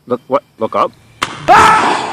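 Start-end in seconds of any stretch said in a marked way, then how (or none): 1.5-2.0 short, loud, high scream; startled scream. Acted. Host looking up at falling tree, startled high scream. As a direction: scream as something drops on you.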